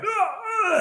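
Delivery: very high wailing cries, faked; fake dying cries. Boy fakes dying cries so the bullies let go, very high wail. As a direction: cry out as if dying, hammed up. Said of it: "I pretended I was, like, dying".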